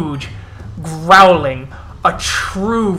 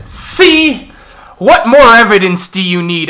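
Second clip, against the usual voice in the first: high, loud gloating accusation; triumphant elder; 4 semitones higher, 7 dB louder than usual. Acted. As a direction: throw the proof in their faces. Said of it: "as he goes".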